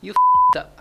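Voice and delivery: shouted high and loud; loud blurt. Real, not acted. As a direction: blurt it out with a laugh. Blurted swear answer, very loud.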